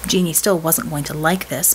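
plain reading voice

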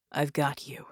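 breathed. Acted. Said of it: "he breathed".

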